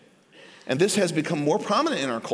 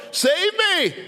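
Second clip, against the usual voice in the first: high, urgent plea for rescue; crying out; 12 semitones higher than usual. Retold or imitated. Peter sinking, crying to the Lord, high and urgent. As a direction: cry out to be saved.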